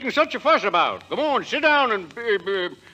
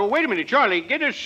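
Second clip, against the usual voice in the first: usual speaking voice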